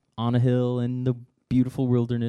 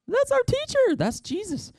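excited recognition. Acted. Disciples recognizing Jesus.

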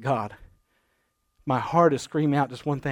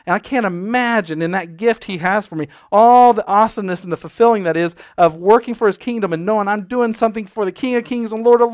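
preaching; 4 semitones higher, 9 dB louder than usual. Acted. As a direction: preach it passionately. Preaching at full force.

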